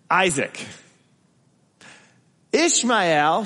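ordinary talk, nothing marked

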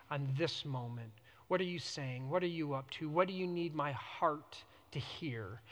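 low, soft, unhurried prayer; quiet, low, open-hearted asking; hushed prayer. Shown as a demonstration. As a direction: speak softly to God, leaving space between phrases. Voices personal prayer to God.

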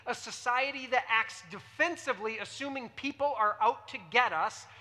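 normal talking voice

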